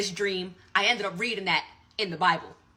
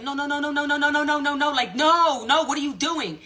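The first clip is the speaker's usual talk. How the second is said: high-pitched run of rapid pleading noes; acted-out alarm, rising in volume toward the end; panicked protest; 7 semitones higher than usual. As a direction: re-enact a desperate objection, pitch held high. Retold silent panic at sibling's removal.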